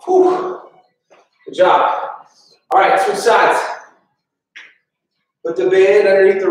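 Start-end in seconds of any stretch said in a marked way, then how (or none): 0.0-0.6 big whoosh of breath after effort; relieved exhale. Real, not acted. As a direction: blow out air, set finished. Exhale after finishing the set.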